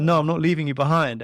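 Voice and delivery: high, loud mimicked child; devoted daughter. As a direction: protest dramatically as the little girl. Voices daughter's dramatic refusal.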